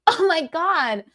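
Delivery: mock gushing compliment to strangers; gushing excitement. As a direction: fawn over her outfit, bubbly. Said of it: "I was like".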